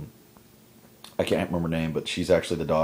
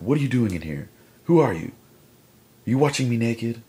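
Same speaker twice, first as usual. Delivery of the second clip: higher-pitched, mock-alarmed demand; acted confrontation; 6 semitones higher, 3 dB louder than usual. Woman confronting the man hiding in her closet, mock angry. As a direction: demand answers as if catching an intruder.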